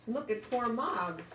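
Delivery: usual speaking voice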